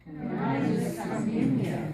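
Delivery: slow, loud choral repeat; said together loudly. Shown as a demonstration. Class repeating after the teacher, loud and slow. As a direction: students chant it back together, slowly. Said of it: "Say it together".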